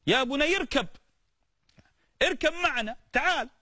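high pleading call to a son. Acted. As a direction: call him urgently to come aboard. نوح ينادي ابنه ليركب السفينة. (نداء أب لابنه بصوت عالٍ مستعطف)

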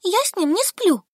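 высоко и громко, с возмущением; Солька отбивается от намёка подруги; возмущённо. A narrator's reading. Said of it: "возмутилась Солька". (возмущённо и высоко)

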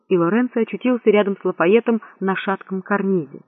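plain reading voice

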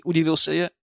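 usual speaking voice

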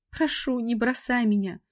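high, slow crying plea; tearful pleading. Acted. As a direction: beg him not to leave. Heroine begs tearfully.

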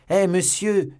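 high, loud hail; calling out. A narrator's reading. Stable boy running after the stranger, calling out. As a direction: shout after someone getting away. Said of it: "s'écria un garçon d'écurie".

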